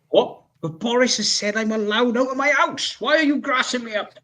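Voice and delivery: high-pitched, affronted retort; indignant protest. Retold or imitated. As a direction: act out being outraged at a snitch. Acted protest at being reported.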